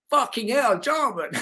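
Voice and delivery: high-pitched mock Dutch exclamation; hammed-up Dutch accent. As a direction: imitate a Dutchman's astonished greeting, breaking into laughter. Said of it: "in this Dutch accent".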